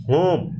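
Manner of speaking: "होम" with the ओ held; long vowel. Shown as a demonstration. Teacher shows long vowels, "होम" with a drawn-out ओ. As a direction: lengthen the ओ in "होम". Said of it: "ओ आ रहा है लेकिन long है".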